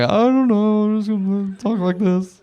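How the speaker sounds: high, slow awkward-guy voice; drawn out, awkward and high; Duncan voice. Retold or imitated. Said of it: "The, the Duncan character started".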